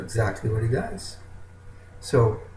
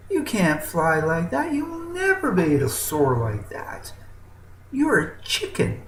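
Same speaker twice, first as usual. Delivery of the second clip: higher, scoffing put-down; mocking chicken; 6 semitones higher than usual. Chicken mocks the eagle's dream, higher and scoffing. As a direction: laugh him down, higher. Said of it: "He says".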